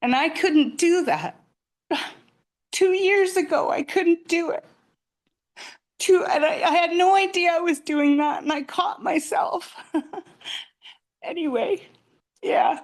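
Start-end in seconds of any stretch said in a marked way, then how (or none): 1.9-12.8 high, choked-up teary voice; holding back tears, laughing through them. Real, not acted. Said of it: "I'm sorry, I'm teary".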